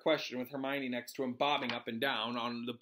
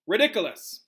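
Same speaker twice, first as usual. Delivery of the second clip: firm, raised demonstration of a spell; teacher modelling; 6 semitones higher, 9 dB louder than usual. Acted. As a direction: say it clearly for others to repeat. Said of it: "After me, please".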